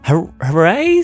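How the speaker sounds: slow, stumbling hooray with rising end; hesitant cheer. Acted. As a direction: join the cheer uncertainly. Girls join cheer hesitantly.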